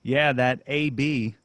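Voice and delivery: slow Southern twang on "A B burger"; Southern drawl. Retold or imitated. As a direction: stretch "A B" out like a Southern friend. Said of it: "they were saying".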